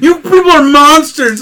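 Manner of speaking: high, loud, slow horrified yell; mock screaming. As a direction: scream it in hammed-up outrage. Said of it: "And he's screaming".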